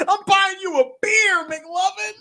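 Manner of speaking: very high, whooping yell; hammed-up high-pitched celebration; mock elated cheer. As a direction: holler it like a thrilled drinking buddy. Acting cop cheering McLovin.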